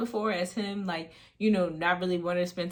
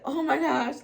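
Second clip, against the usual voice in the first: higher, briefly acted fright; a quick retold yelp of alarm; so scared; 8 semitones higher, 4 dB louder than usual. Scared teen reacts to boyfriend's outburst, retold fright. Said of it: "like, so scared".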